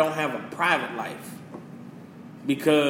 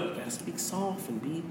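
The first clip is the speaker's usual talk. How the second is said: much softer, slowed mock calm; 8 dB quieter than usual. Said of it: "society teaches what?".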